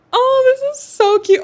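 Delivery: very high, loud gushing; real high-pitched delight; delighted squeal. Real, not acted. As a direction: squeal over something adorable. Delighted by the new books.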